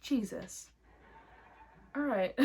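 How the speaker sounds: ordinary talk, nothing marked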